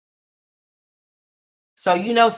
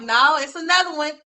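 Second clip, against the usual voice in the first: mock dismay; 10 semitones higher, 4 dB louder than usual. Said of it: "here you come like".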